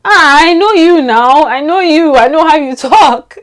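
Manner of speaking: mock call-out. Vlogger mockingly calling out a friend's fake accent, teasing call-out. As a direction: catch her out with a knowing jab.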